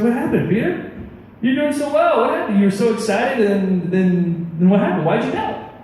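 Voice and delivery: high-pitched, acted friendly puzzlement; puzzled questioning. Jesus coming up to Peter after he sank, high puzzled questioning. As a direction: ask it high, as a gentle chiding.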